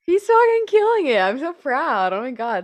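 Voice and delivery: high, loud fan gushing; excited. Real, not acted. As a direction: squeal with pride for him. Fan gushing about Louis.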